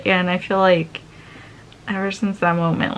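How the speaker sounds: normal talking voice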